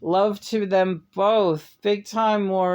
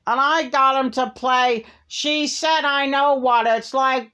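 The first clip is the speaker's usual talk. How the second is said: much higher, louder, slow mocking Caroline voice; imitating Caroline; 8 semitones higher, 4 dB louder than usual. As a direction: send up her cheerful Facebook boast, drawn out. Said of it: "this is what she sounds like".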